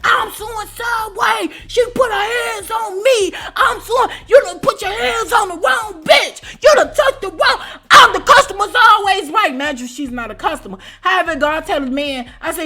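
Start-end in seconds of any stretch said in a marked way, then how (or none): 0.0-9.5 high, slow, hammed-up wailing shriek; mock screaming woman. The lady on the ground screaming she'll sue Subway, wailing. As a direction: imitate her wailing threats. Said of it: "she screaming to the top of her lungs".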